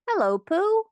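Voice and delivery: high, loud little squeak; excited squeak. A narrator's reading. Young Roo greeting Pooh at the party, excited squeak. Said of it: "he squeaked".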